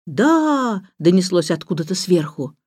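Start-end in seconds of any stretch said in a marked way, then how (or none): 0.0-0.9 calling back. A narrator's reading. Answer shouted from high above.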